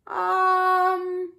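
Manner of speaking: a long loud hum of appetite; cried out. A narrator's reading. Turtle crying out at talk of honey, eager cry. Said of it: "cried the turtle as she rubbed her belly".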